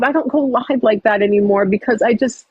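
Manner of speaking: usual speaking voice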